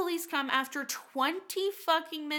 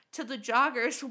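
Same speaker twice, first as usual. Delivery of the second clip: ordinary delivery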